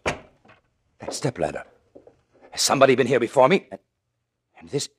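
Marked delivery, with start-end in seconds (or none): 2.5-3.7 sudden fast frightened question; startled, rushing with fear; alarmed. Acted. Finds stepladder, alarmed.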